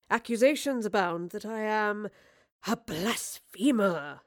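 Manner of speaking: slow, drawn-out growl on the last words; mock-theatrical growl. A narrator's reading. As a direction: growl it with hammy, mock drama. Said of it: "he growled with mock theatrics".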